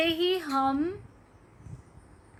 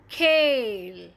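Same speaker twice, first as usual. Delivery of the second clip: खेल drawn out with a long e; slow model reading; 5 dB louder, much slower than usual. Shown as a demonstration. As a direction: model खेल slowly for the class. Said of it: "उच्चारण change हो जाता है".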